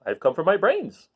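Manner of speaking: mildly nervous request; a little uneasily. A narrator's reading. Scarecrow uneasily asking Oz for his brains. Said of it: "a little uneasily".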